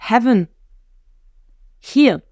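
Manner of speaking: "Heaven" and "Here" each with a breathed H; deliberate aspirated "Heaven" and "Here"; sounded H. Shown as a demonstration. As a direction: puff out the H of "Heaven", pause, then of "Here". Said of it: "Tu nous fais les haches".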